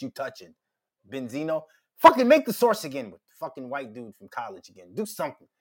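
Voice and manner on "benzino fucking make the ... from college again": normal talking voice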